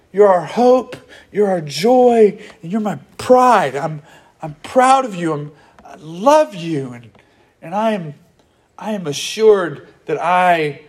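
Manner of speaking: proud tender apostle. Retold or imitated. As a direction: tell them you love them. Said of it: "He's going".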